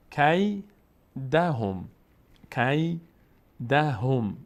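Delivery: slow drawn-out tajweed chant; Quran recitation. Shown as a demonstration. Teacher reciting the verse for the class. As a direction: recite الم یجعل slowly and evenly.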